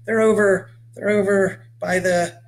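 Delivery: put-on a nervous boy caught off guard; flustered kid repeating himself; stammering boy. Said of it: "Mitch stammered".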